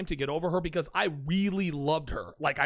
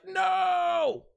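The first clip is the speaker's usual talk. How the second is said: high drawn-out cry of despair; long mock howl of defeat; anguished shout; 14 semitones higher, 4 dB louder than usual. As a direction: yell no as if struck by an arrow. Reacting to being disarmed.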